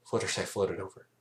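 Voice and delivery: usual speaking voice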